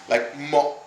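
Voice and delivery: "mo" cut off short with a glottal stop. Shown as a demonstration. Showing viewers the Vietnamese glottal stop. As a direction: stop the breath right after "mo". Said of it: "instead of moat".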